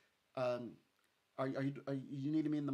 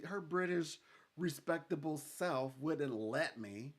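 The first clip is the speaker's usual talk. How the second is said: normal talking voice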